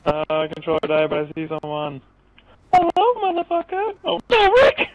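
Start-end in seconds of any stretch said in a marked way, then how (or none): 2.7-4.0 excited shout. Real, not acted. Spots enemy in game. 4.0-4.9 panicked shout. Real, not acted. Controller dies mid-fight.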